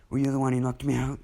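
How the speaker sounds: put-on furious girl shouting at Kirishima; angry demand. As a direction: shake him and demand it. She grabs Kirishima, demands.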